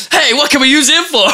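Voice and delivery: mock hero voice. Superhero colleague voiced.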